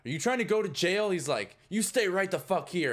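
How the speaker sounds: high, loud cop threat; angry guard yell. Acted. Guard yelling threats at the stumbling character. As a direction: snap at him to stay put.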